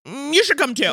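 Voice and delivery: mock hostess's grudging invite; offhand invite. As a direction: invite her as an afterthought. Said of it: "Barbara's like".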